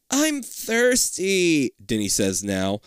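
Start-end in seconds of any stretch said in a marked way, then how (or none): 0.1-1.7 high, drawn-out whine; whiny little brother. Acted. Denny demanding a drink, whiny. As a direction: whine it very slowly, pitched up.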